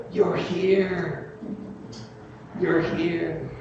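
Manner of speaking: soft, high, full of wonder; tender. Retold or imitated. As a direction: hush it like a joyful father. Father greets returning son.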